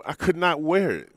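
high, loud emphatic protest; exasperated outburst. Real, not acted. Player tells host about his stretched sweater, exasperated outburst. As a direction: cry out in comic outrage.

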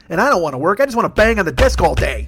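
ordinary talk

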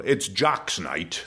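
slow, grand put-on dad; dad's noble voice. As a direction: say it slowly like a noble sacrifice. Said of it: "And he said".

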